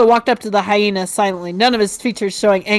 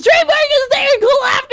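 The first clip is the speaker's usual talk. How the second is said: shout. Acted. Ed's raucous noise in story.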